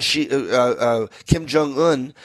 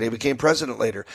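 usual speaking voice

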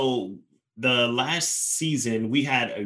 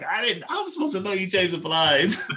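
high-pitched, exasperated comic protest; mock protest; 9 semitones higher than usual. Husband defending himself to wife over the blinds, mock protest. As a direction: plead innocence, voice shooting up. Acted reply in retold home quarrel.

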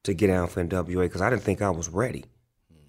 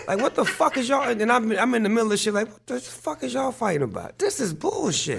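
high-pitched, incredulous complaint; exasperated protest; 14 semitones higher than usual. Retold or imitated. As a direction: retell your outburst, pitched way up. Retelling his outburst at fighting bandmates.

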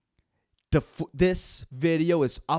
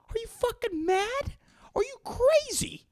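much higher, incredulous squeak; shocked listener; 4 dB quieter than usual. Retold or imitated. Imagined friend reacting to his wish, high and disbelieving. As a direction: play a horrified friend.

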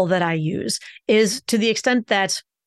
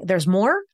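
"more" lifted, left hanging. Shown as a demonstration. Coach showing a first name's unfinished tune, rising. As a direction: end "more" on a rise, promising continuation. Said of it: "put our first name on the up".

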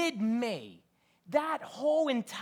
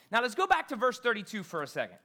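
usual speaking voice

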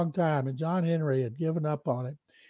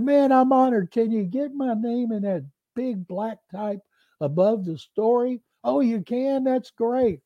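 much higher, eager and gushing; thrilled and honored; 6 semitones higher than usual. Retold or imitated. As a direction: gush like you've made all-pro. Player thrilled by fake interview.

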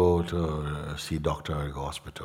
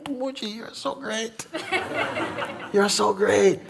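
much higher, gushing praise with a giggle; gushing admirer; slower than usual. Retold or imitated. Visiting teacher gushes over Mooji, high and giggling. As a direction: play the starry-eyed fan flattering his teacher. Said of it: "and he goes".

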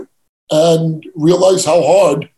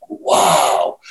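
awestruck wow; 11 semitones lower than usual. Retold or imitated. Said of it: "I'm like".

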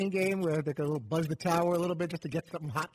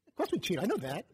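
Martin Short liar. Retold or imitated. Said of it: "that S N L Martin Short character".

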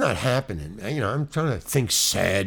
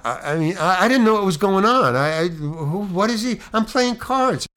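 high, agitated panic, louder and rising; flustered protest; 7 semitones higher, 4 dB louder than usual. Acted. Actor suddenly told to cry on cue, flustered and high-pitched. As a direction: sputter in mounting disbelief. Caught unprepared, protesting to himself.

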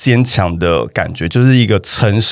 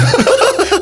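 sudden high-pitched laugh; high burst of laughter. Real, not acted. Laughing at the offering joke with a squeaky laugh.

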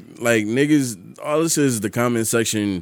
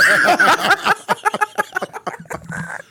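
high burst of laughter. Real, not acted. Laughs at own insult.

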